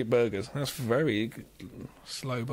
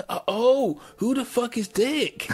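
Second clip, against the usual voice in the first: high, slow, louder outraged thug; mock outraged thug; 11 semitones higher, 3 dB louder, slower than usual. Host imitates the gangster's outraged outburst, high and slow. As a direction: explode in hammed-up disbelief.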